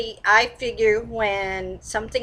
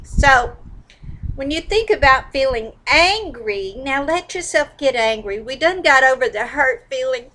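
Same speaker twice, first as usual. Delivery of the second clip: ordinary talk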